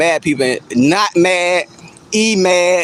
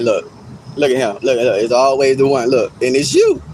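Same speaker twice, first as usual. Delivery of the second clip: low-pitched pointing-him-out voice; mock onlookers; 6 semitones lower than usual. Everybody pointing at the mad chatter, low mock onlookers. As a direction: play the crowd pointing, pitched well down. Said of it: "pointing at you like".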